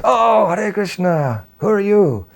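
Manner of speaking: drawn-out, high-pitched welcome; slow blissful greeting. Retold or imitated. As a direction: greet him slowly, dreamily. Blissful devotee opens the door.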